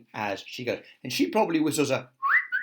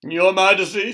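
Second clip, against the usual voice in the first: loud, raised formal address; soldier; 8 semitones higher, 11 dB louder than usual. A narrator's reading. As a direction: hail the king like a soldier. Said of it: "said the soldier".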